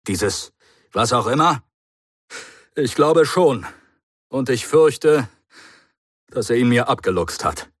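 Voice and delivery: high, fast and loud self-reproach; furious at being tricked; the Doctor, agitated. Acted. The Doctor rages at himself before the Brigadier, high and loud.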